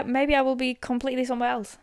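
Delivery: usual speaking voice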